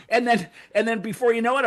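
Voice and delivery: ordinary delivery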